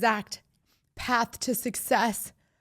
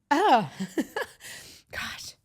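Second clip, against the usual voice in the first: choked up, higher, breaking off into an embarrassed laugh; getting emotional; 6 semitones higher than usual. Real, not acted. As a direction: lose the thread as real emotion rises. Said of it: "I'm kinda getting emotional".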